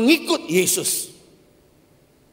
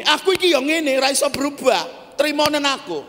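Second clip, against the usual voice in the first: mock stubborn husband; 8 semitones higher than usual. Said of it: "bapak-bapak yang suka ngamuk, ngomongnya gini".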